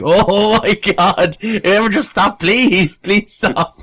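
drawn-out, high mock plea; desperate pleading. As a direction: beg loudly for it to stop, hammed up. Imagining himself stricken on train.

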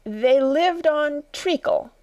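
high, slow, drawn-out creature voice; pondering Dormouse. Acted. Dormouse answering Alice after thinking, high and slow.

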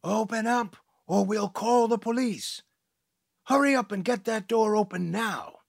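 high, loud yell through the door. Acted. Teacher yelling through the door to open up, loud. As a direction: bellow at them to open up. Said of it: "Scholastic Studies yelled".

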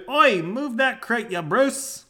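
high, loud, slow Aussie holler; Australian shout. Acted. Local yelling at aliens driving a tank, Australian shout. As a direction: yell it out in mock Australian.

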